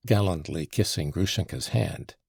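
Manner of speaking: usual speaking voice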